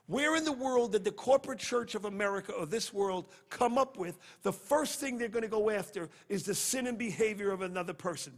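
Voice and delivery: normal talking voice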